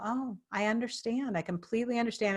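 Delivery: soft, soothing reassurance; retold calm. Trainer answering an agitated sceptic, softly calm and understanding. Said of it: "I just got calmer and calmer".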